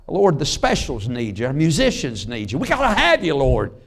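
a revival prayer building to a cry; pleading prayer. Acted.

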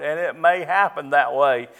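ordinary delivery

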